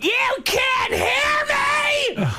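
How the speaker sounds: very high, slow drawn-out shout; mock yell. Comedian imitating his mother screaming into dad's ear, slow high yell. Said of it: "she's going".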